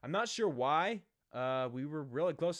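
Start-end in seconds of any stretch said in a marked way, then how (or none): none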